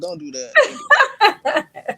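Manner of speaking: drawn-out laughing plea, high; laughing protest. Real, not acted. Guest begging the host to stop the Jersey impression, laughing. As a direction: laugh through the words. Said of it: "laughing protest at friend".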